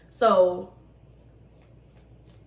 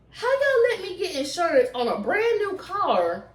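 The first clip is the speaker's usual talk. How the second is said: high, disbelieving mock complaint to the insurer; incredulous rant; 9 semitones higher than usual. Venting at Geico in disbelief, high mock outrage. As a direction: ask it like you can't believe they let you. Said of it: "I'm just like".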